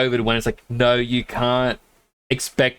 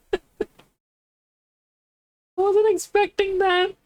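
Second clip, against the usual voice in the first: much higher, slow amazed exclamation; genuine surprise; slower than usual. Real, not acted. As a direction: let the shock lift your voice. Shocked by the hype train.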